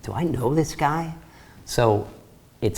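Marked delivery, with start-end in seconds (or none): 0.0-1.1 Linda wondering. Retold or imitated. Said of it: "you know what Linda thinks".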